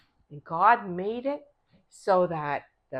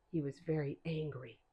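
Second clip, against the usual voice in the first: plain reading voice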